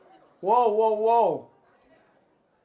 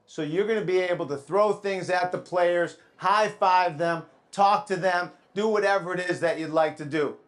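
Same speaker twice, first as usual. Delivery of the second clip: normal talking voice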